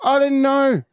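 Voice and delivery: high, exasperated retold protest; defensive outburst. Dad defends himself to screaming daughter, retold defensive outburst. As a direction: argue back at a high pitch.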